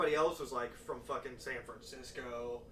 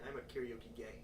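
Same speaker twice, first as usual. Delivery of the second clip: whispered quip; 3 semitones lower, 9 dB quieter than usual. Real, not acted. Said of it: "whispered joke".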